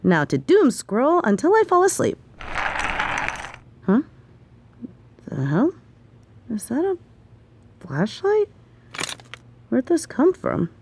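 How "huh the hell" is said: ordinary delivery, nothing marked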